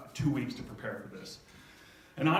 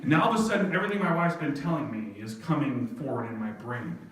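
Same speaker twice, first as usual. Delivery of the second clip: normal talking voice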